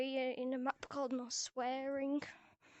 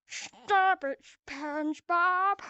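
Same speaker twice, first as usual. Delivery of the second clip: loud, stretched cartoon scolding; meme voice; 6 dB louder, slower than usual. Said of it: "make memes with my voice".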